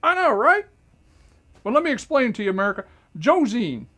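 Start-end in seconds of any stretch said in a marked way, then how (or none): 0.0-0.6 mock indignant. Mock outraged self-praise.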